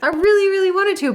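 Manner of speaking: high, fast, giddy; excited. Real, not acted. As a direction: bubble over with glee. Giddy about the surprise.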